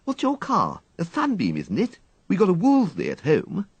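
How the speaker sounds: put-on high, chatty girlish voice; bright-eyed schoolgirl showing off; schoolgirl Peggy. Peggy chats about cars.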